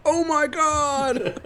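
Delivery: high, slow startled shriek; drawn-out shocked cry through laughter. Retold or imitated. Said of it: "he's like".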